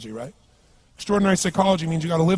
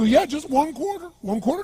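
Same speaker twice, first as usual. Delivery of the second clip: higher, wobbly imitation of a beggar; drunk beggar voice; 10 semitones higher than usual. Answering the young man, a high wavering beggar's voice. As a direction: whine it like the old drunk.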